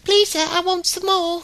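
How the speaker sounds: put-on high, pleading orphan child voice; Oliver Twist beggar kid. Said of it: "kinda, kinda kid".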